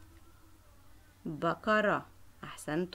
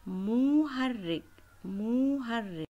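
slow drilling; 2 semitones higher, 4 dB louder, much slower than usual. Shown as a demonstration. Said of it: "teacher drills the word slowly".

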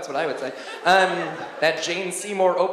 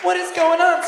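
much higher, louder What is, what is going on; baffled outburst; 11 semitones higher, 6 dB louder than usual. Retold or imitated. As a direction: blurt it out, totally lost. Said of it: "And I'm like".

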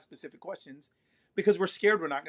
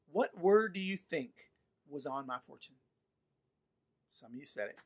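normal talking voice

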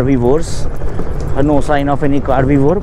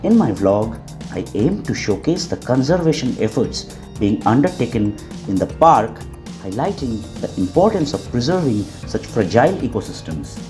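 calm, polished closing narration; voiceover narration; 2 semitones lower, 7 dB quieter than usual. Acted. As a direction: read it like a documentary outro.

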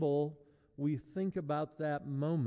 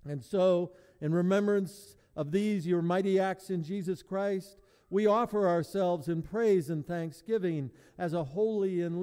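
loud, solemn intoning; liturgical intoning; 5 semitones higher, 7 dB louder than usual. Real, not acted. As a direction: proclaim it to the church. Eucharistic prayer intoned.

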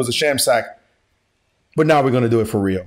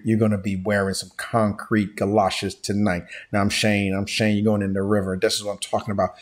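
put-on low, quiet old-movie gangster with slushy s sounds; hushed mobster henchman, lower and softer; James Cagney; 5 semitones lower, 5 dB quieter than usual. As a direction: threaten like an old Hollywood mobster, low and soft. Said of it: "like the James Cagney old school Hollywood movies".

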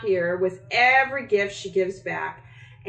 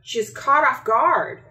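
usual speaking voice

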